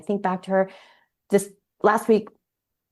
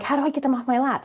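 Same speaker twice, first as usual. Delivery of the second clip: exasperated; 7 semitones higher, faster than usual. Retold or imitated. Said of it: "resentment of like".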